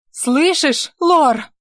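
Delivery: shouting out of breath from riding; breathless shout. A narrator's reading. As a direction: call out breathless, mid-gallop. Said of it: "Чуть прерывисто от скачки крикнула".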